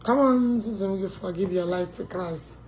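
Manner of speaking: normal talking voice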